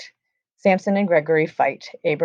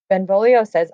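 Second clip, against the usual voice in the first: normal talking voice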